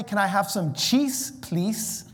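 please said with a hissing s, laughing; please ending in a sharp s. Shown as a demonstration. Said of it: "words with S's that we say a Z".

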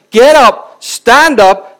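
commanding call. Acted. Preacher calling the congregation to stand, loud commanding call. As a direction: bark the call to get up and stand.

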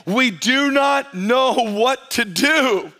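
slow, high, deliberate reading of the verse; slow and emphatic. Shown as a demonstration. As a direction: lay the key phrase out word by word for the church.

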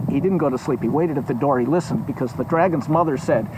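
usual speaking voice

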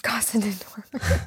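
breathy echoed whisper. Acted. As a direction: whisper it back playfully. Echoing the house name playfully.

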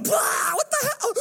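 very high, drawn-out mock cry; shocked spluttering. Imitating a boy surfacing from the pool, shocked. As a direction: burst up spluttering in alarm.

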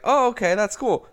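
high, chirpy mock approval; sing-song sarcastic okay, that's cool; mock cheerful. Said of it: "And I was like".

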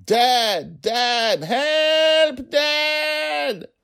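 put-on high, loud, frantic child's screams; panicked little girl screaming; shouting for help. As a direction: scream for dad in terror.

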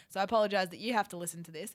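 normal talking voice

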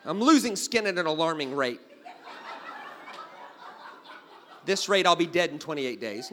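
loud hammed-up alarm at shedding skin; mock panic. Man waking to dandruff on pillow, loud mock panic. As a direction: cry out Oh, no! then keep up the mock dread. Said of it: "looks at the skin and goes".

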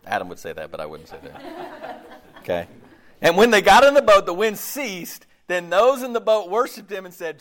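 ordinary talk, nothing marked

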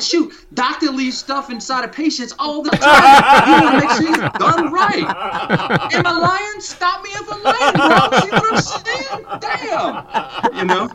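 laughing through a high, hammed-up New York accent. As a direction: crack up while holding the New York accent. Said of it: "I'm gonna put it in a New York accent".

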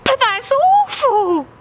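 very high slow quacking wail; quacked. Acted. Dave dismayed at the odds, high quacking. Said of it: "quacked Dave".

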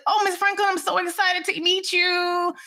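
high-pitched gushing fan, drawn out at the end; nerdy excitement. Retold or imitated. Young reporter meets her hero [name], nerdy and gushing. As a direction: replay an over-eager fan greeting a hero. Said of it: "I was all nerdy".

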